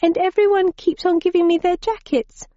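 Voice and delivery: put-on high, huffy girlish complaint. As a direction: sulk like a put-out young girl. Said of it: "she continued in a huffy tone".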